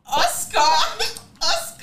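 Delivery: high laughing repeat of Oscar. Real, not acted. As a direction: squeal it out while laughing. Caught out in word game.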